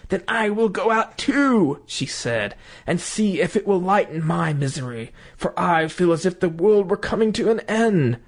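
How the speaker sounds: lightly voiced dread; desperate wife.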